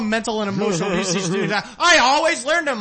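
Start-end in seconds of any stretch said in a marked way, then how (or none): 1.5-2.8 high mock mother boasting; hammed-up mother bragging about discipline; mimicked proud mom. Escaped prisoner's mother on local news, hammed-up pride. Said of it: "Oh, I just".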